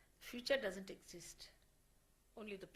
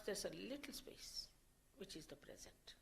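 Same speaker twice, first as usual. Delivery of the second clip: soft whisper; 8 dB quieter than usual. Acted. Guru to seekers, whispering about the silent present moment. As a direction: drop to a whisper on the space between thoughts. Hushed point about inner stillness.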